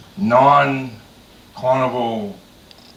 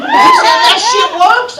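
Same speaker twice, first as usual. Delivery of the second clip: excited exclaim; 10 dB louder, much slower than usual. Retold or imitated. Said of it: "He said".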